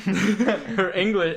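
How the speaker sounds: slow words through laughter; laughing. Real, not acted. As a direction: laugh as you say it. Laughing before a remark.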